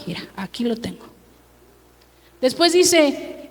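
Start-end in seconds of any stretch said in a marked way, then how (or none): 0.1-1.1 hushed, secretive whisper, a touch fast; sly whisper. Acted. Preacher voicing a proud man, whispered and sly. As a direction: lean in and whisper it like a boast.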